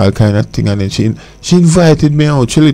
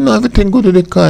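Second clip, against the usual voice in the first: quick higher retort; fast; 10 semitones higher, faster than usual. Retold or imitated. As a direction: rattle it off fast and high. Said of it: "She said".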